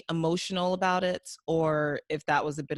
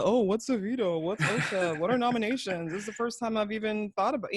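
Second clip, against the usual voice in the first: mock clueless newbie, pitched a little up; hammed-up innocent questions; playing dumb; 3 semitones higher than usual. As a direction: imitate someone feigning total ignorance. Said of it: "dumb, playing sheep this whole season".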